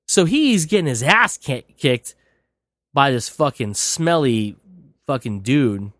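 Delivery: ordinary talk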